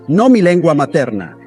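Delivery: ordinary talk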